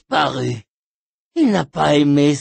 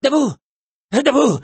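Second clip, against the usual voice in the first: barked command; 9 semitones higher, 5 dB louder than usual. Acted. Ordering someone up.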